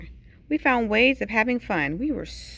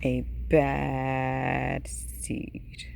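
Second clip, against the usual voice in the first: very slow, low, breath-heavy menace; ominous refrain; 8 semitones lower, 4 dB quieter, much slower than usual. A narrator's reading. Seed repeating his dark name, slow low ominous whisper. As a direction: draw BAD right out, deep and dark.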